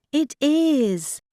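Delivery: lightly insist, standing your ground. Acted. Joanne insisting to Pat she has read the map right, mildly insistent. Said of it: "insisted Joanne".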